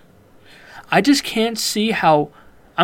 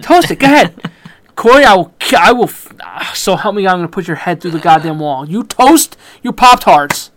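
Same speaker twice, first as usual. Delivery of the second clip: mock outrage; 6 dB louder than usual. Host yells in mock rage at Corey for toasting Pop-Tarts. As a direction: fake threats, yelling in jest.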